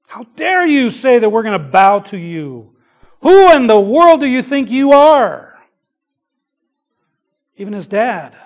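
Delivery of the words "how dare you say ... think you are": rising into a furious shout; brothers' angry outburst. Retold or imitated. Jealous brothers turning on Joseph, shouting angrily. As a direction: hurl it at the dreamer brother, building to a yell. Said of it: "his brothers were angry with him".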